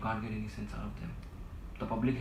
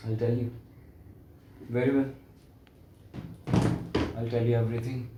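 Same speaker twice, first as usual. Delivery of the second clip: loud, emphatic announcement; worked-up insistence; 2 semitones higher, 7 dB louder than usual. Acted. Agitated man about to tell his friend everything, loud and insistent. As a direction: force out each short phrase loudly.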